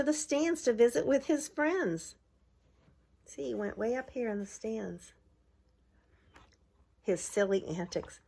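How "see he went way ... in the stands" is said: ordinary delivery, nothing marked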